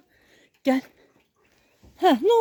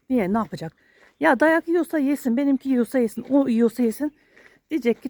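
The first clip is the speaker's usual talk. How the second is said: normal talking voice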